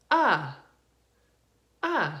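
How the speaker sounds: two separate "uh" vowels, paused between; schwa sounds. Shown as a demonstration. Teacher showing first and last A, separate "uh" sounds. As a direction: isolate each "uh" as a schwa. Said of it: "They sound like".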